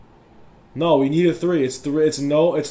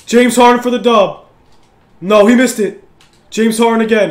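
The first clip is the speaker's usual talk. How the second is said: loud, raised, urgent commentary; excited play call; 5 semitones higher, 9 dB louder than usual. Real, not acted. Gamer calling Harden's shot for the win, excited shouting. Live calls of deciding shot.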